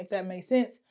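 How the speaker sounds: ordinary delivery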